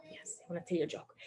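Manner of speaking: fast conspiratorial whisper. Acted. Host whispers to the kids about the joke, fast and hushed. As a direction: lean in and whisper it like a secret.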